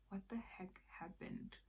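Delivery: breathy whispered what-the-heck; hushed disbelief. Retold or imitated. Seeing all the missed calls, a hushed stunned whisper.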